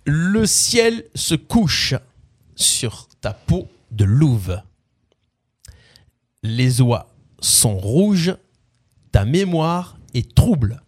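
slow, deliberate spoken lyrics with pauses; lyric recitation. Acted. Quiz host giving players song lyrics, slow recitation. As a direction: recite it like solemn verse, line by line.

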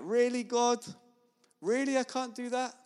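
incredulous. Acted. Believer questioning God's rules, mock incredulous. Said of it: "And I'm like".

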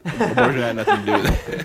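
high, laughing protest through giggles; laughing denial. Real, not acted. Laughing at friend's mix-up, insisting it's unrelated. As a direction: protest while cracking up.

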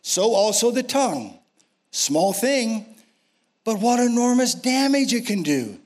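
rising preacher's build, high; preaching at full force. Real, not acted.

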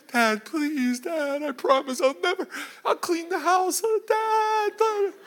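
hammed-up high-pitched begging; pleading child. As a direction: whine and beg like a desperate little boy. Son desperately begging dad.

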